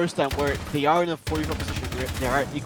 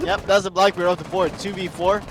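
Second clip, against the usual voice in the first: high, raised voice after a laugh; excited, amused; 6 semitones higher, 6 dB louder than usual. Real, not acted. Caster calling Blackbeard off the board, amused excitement. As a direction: ride the thrill of the catch.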